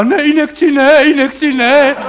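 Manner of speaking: panicky refusal. Retold or imitated. Fainting hero refuses doctor's injection.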